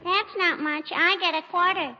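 put-on high, sweet child's voice, sing-song; little girl voice. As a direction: play a cheeky little girl. [name] plays little girl Teeny.

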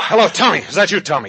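loud, high, frantic call; agitated husband shouting down the phone; urgent phone demand. Acted. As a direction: demand to know who has picked up.

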